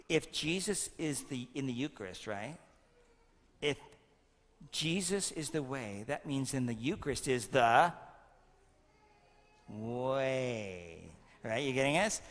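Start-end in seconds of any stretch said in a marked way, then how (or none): none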